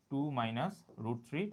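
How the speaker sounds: Two minus root three stretched out very slowly; slow dictation. Shown as a demonstration. Teacher dictating the step slowly to students. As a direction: say each word as you write it.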